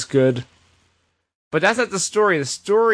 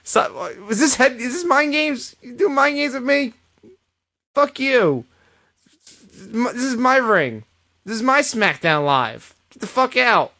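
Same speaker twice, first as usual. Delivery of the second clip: higher-pitched hammed-up fury; a put-on, pitched-up furious tirade; mock angry rant; 10 semitones higher than usual. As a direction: lay into him like a fed-up wrestler. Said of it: "And I was like".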